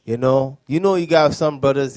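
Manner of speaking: ordinary delivery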